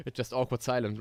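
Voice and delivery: usual speaking voice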